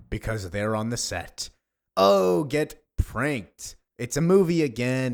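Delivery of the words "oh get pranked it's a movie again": drawn-out, loud jeer; loud taunt. Acted. Actor revealing the movie prank, loud taunting. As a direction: crow it loudly as a gotcha. Actor mocks him on set.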